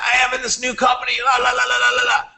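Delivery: high, singsong brag about a new company; mock job-hopper. Mocking people who jump between companies, singsong brag. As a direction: mimic a flighty job-hopper bragging. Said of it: "They're back".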